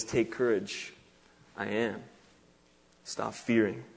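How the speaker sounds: very soft, low and spaced out; hushed solemnity. Retold or imitated. Preacher voicing Jesus approaching the boat, hushed. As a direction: drop to a quiet, calming low voice.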